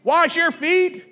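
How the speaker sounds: hammed-up mother yelling at kids; mock mom yell. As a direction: shout it like a mom at the door. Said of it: "mom would yell".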